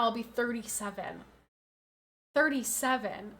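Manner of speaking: ordinary talk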